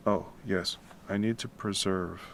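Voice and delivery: normal talking voice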